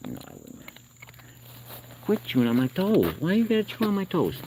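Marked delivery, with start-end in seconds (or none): 2.0-4.3 high-pitched pet-scolding voice; playful scolding. Real, not acted. Man to raccoon chewing his toes, high-pitched scolding. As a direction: climb high into a playful complaint.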